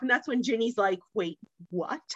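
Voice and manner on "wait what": soft, half-laughed wait what; startled giggle from the background; laughing disbelief. Retold or imitated. Friend overhearing from the background, laughing quietly.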